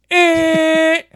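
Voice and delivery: high, held car-horn blare; car horn imitation. Driver honking impatiently from behind, imitated car horn. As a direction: lay on a horn with your voice. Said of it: "somebody lays on their horn".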